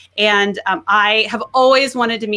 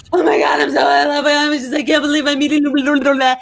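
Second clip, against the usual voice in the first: higher, louder starstruck squeal; starstruck gushing; 5 semitones higher, 4 dB louder, faster than usual. Retold or imitated. Meeting her idol, loud starstruck gushing. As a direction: gush like a starstruck fan. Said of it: "I'm like".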